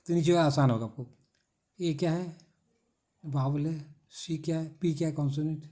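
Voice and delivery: ordinary delivery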